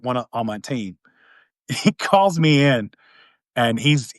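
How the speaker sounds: usual speaking voice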